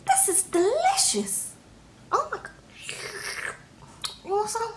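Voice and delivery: high, squeaky tipsy voice; tipsy woman. Retold or imitated. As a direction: play her gone on one margarita. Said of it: "but she was gone".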